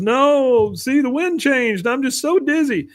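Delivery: very high, dazed and pitiful; high mock-dizzy Bill. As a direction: sound woozy and helpless up in the tree. Bill explaining to kids.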